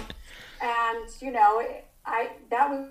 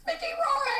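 much higher, giddy shriek of the name; excited squeal. Retold or imitated. To her roommates on the car phone, a much higher excited squeal. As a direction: squeal the name like a starstruck fan. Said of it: "we're, like, yelling".

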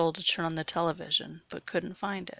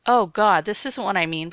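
louder, alarmed objection; loud protest; 10 dB louder than usual. Acted. Jared tied to the chair, loudly protesting to Sue. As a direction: push back hard, rattled. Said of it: "protested Jared".

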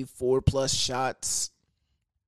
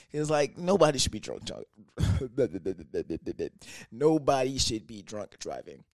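usual speaking voice

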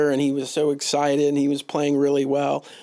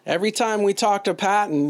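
ordinary talk, nothing marked

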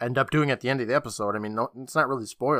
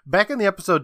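usual speaking voice

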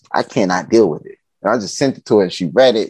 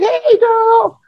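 very high-pitched squealed hello; excited mimicry of a delighted relative; gushing greeting; 3 dB louder than usual. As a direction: greet her like a long-lost girlfriend. Family greets her like old friend.

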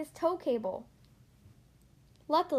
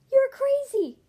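loud, high-pitched shout; calling out; 12 semitones higher, 9 dB louder, slower than usual. A narrator's reading. Lightning to Mater driving backwards, calling out loudly. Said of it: "Lightning called to him".

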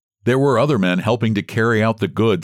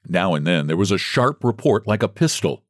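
plain reading voice

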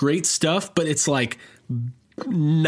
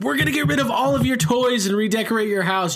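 high, fast, gushing makeover voice; excited makeover host; 7 semitones higher, faster than usual. Pretend. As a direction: burst in like an eager TV makeover host. Imagined Queer Eye hosts' line.

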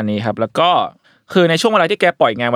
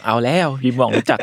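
mock amazed aside. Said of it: "แล้วกูบอก".

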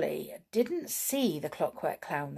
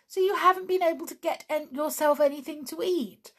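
anxious cry; 9 semitones higher, 4 dB louder than usual. A narrator's reading. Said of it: "cried Tiptoe".

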